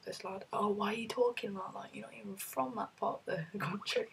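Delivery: normal talking voice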